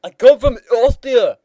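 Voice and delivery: prank-call Arnold in a bad Austrian accent; loud mock Austrian accent. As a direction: belt out a cheap Austrian accent. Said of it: "The home of Arnold Schwarzenegger".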